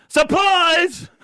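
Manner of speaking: sudden high yelled punchline in a put-on accent; Chinese accent. Chinese fella jumping out at the foreman, high accented yell. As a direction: jump out and yell it. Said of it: "I love when you do your accents".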